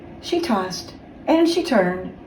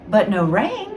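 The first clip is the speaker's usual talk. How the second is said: ordinary delivery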